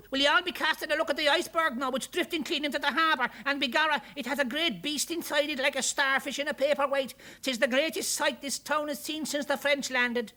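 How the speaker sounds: high, loud Irish glass blower; excited Irish cry to the whole workshop; calling out. A narrator's reading. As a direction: burst in calling the news, pitched high. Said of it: "calling out".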